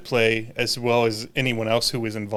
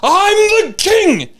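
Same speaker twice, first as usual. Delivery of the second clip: put-on high, loud, drawn-out yell of a boy king; mock royal outburst, much higher and louder; Joffrey's shout; 8 dB louder, much slower than usual. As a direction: bellow it up high and slow like a spoiled young king. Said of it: "Joffrey was like".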